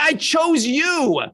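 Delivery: high, slow acted outburst; exasperated divine rebuke; very angry God. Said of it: "God gets very angry and says".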